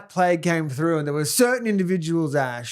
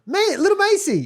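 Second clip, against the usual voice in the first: high-pitched incredulous cry; amused disbelief; 13 semitones higher than usual. Real, not acted. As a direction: squeal it in mock shock. Mock disbelief at Macy hitting.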